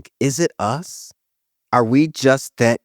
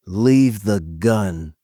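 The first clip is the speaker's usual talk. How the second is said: slow, heavy Godfather-style delivery; mock Italian mobster; 4 semitones lower, much slower than usual. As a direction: drawl it like a mafia don. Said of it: "I am going to say".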